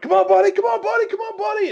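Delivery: high-pitched urging cheer, rapid and repeated; excited cheering. Retold or imitated. Hunter urging his dog away from danger, excited. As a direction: amp him up with a high, excited call. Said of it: "I got excited".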